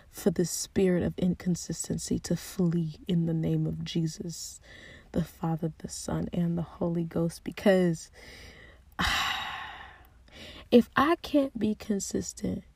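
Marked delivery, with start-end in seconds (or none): 0.1-7.4 prayer. Real, not acted. Praying against inconsistency.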